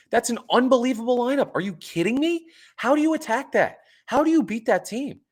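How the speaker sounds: high excited disbelief. Real, not acted. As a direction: marvel at the unstoppable lineup. Raving about the lineup.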